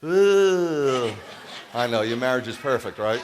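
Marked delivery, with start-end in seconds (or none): 0.0-1.1 laughing groan. Real, not acted. Reacting to audience heckle.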